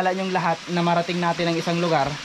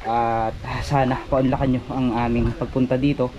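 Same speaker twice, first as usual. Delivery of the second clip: respectful prayer; 4 semitones lower than usual. Acted. Asks spirits for permission.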